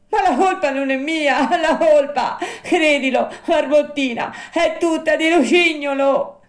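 high, loud sobbing puppet; tearful protest. Acted. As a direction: wail your excuses through stagey sobs. Weeping puppet protests his innocence.

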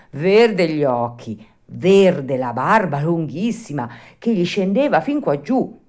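plain reading voice

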